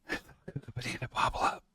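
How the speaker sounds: breathy unvoiced whisper of the nonsense; soft whispered echo. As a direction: whisper it close and secret. Nonsense phrase repeated whispered.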